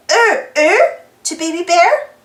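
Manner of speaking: ordinary delivery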